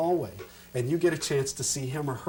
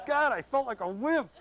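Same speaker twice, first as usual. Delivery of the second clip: embarrassed self-talk; 11 semitones higher than usual. Retold or imitated. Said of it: "you end up going".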